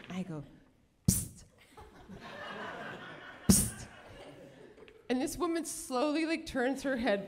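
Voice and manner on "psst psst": sharp hissed attention call; furtive psst. Retold or imitated. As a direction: hiss discreetly to someone nearby. Getting attention between plane seats.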